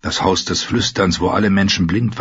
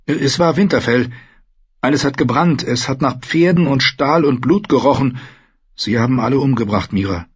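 higher-pitched character voice, falling at the end; lightly voiced Bran, upset; 6 semitones higher than usual. A narrator's reading. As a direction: report a terrible vision just seen. Bran reports his vision. (leicht verstellte, höhere Stimme, aufgewühlt)

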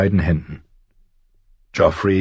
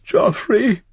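hoher, leicht weinerlicher Ruf; schluchzend. A narrator's reading. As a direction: ruf seinen Namen unter Tränen. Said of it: "schluchzte sie". (Sansa ruft den Prinzen leicht schluchzend)

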